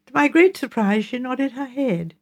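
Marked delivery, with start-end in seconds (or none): none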